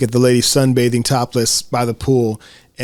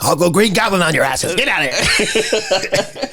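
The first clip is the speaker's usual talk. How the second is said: laughing mock threat; 5 semitones higher than usual.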